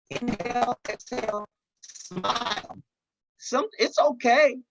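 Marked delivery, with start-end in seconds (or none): 0.1-2.8 soft, calm breathing cues, softer toward the end; guided breathing. Shown as a demonstration. Coaching stressed listeners to breathe, soft and calm. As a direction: talk the listener gently through a breath. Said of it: "Just take some deep breaths and exhale".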